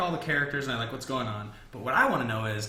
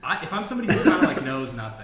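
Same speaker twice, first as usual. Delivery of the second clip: laughing; 7 dB louder than usual. Real, not acted. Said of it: "You're, like, Jon Snow status".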